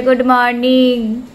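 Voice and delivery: loud, drawn-out cross greeting; playfully stern, stretched-out greeting; mock-angry teacher. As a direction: imitate a grumpy teacher, slow and loud. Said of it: "টিচারটা এরকম রেগে রেগে বলে".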